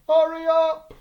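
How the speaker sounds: loud, high impatient yell. Retold or imitated. Derby Boy yelling across the empty shop, loud and high. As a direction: shout to hurry the shop owner. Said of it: "so Derby Boy yells out".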